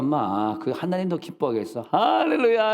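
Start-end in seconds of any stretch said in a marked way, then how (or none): 1.9-2.7 exultant hallelujah. Real, not acted. Preacher exclaims praise.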